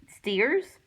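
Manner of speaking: usual speaking voice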